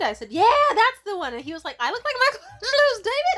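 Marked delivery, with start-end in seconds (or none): 0.3-1.3 high, loud delighted agreement; excited confirmation. Retold or imitated. 1.8-3.4 high, loud incredulous question; astonished disbelief. Retold or imitated. His astonished reply, retold.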